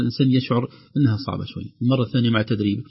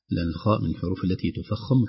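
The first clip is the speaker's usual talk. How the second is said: ordinary talk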